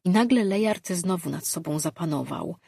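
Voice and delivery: ordinary delivery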